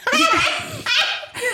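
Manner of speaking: high, cackling burst of laughter; helpless high laugh; real laughter. Real, not acted. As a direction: burst out laughing at a mean joke. Cracks up at friend's joke.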